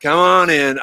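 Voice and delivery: put-on old John calling the warden in again, loud. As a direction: shout it toward the trees with a grin. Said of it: "steps out of the cabin and says".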